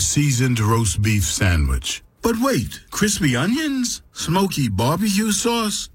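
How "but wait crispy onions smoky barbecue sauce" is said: high, amazed gasp at each topping; sudden excited delight; surprised. Acted. Surprised at new sandwich toppings.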